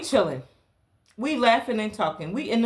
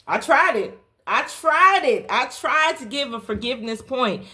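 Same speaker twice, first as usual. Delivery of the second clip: louder and higher on the repeat; emphatic building; 5 semitones higher, 4 dB louder than usual. Real, not acted. Storyteller to viewers, insisting with rising force. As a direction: hammer home that she really tried.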